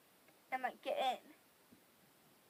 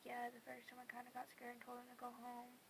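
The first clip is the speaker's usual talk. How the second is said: very soft, even-pitched confession; sheepish admission; 13 dB quieter than usual. Retold or imitated. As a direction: confess it very quietly.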